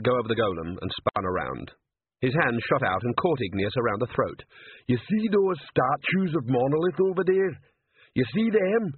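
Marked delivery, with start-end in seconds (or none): 4.9-8.9 high-pitched, slow troll threat; troll's menacing growl. A narrator's reading. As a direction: make the troll's demand menacing, pitched well up. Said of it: "he growled".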